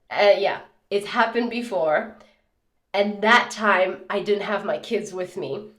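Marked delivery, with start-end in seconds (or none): none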